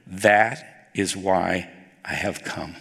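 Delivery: slow, low and deliberate; quoting Jesus slowly and low; solemn emphasis. Shown as a demonstration. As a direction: weigh every word. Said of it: "Listen to this word".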